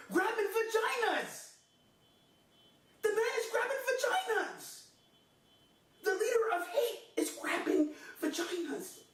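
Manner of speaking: hammed-up hysterical indignation; overwrought. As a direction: stretch the last word, pitch way up. Overwrought climax of the rant.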